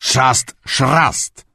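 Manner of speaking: mock his words back angrily; angry mockery. A narrator's reading. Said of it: "wkurzył się Dubiela".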